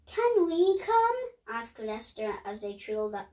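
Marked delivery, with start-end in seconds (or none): 0.1-1.1 put-on pleading child voice. Lester begs to come along.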